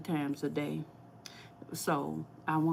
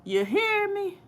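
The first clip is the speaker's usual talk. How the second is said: put-on playful Saint Louis accent, higher and rising in pitch; 4 dB louder than usual. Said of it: "That's my Saint Louis accent".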